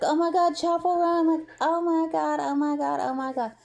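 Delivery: high, breathy-fast fangirl squeal, fading; mock starstruck gasp. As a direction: gush like a starstruck fan. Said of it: "She's like".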